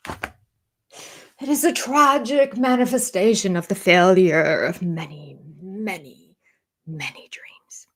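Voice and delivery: slow overwrought quote, fading to a whisper; mock pompous. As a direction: draw it out theatrically, dying away on each many. Mocks his pompous line, repeated.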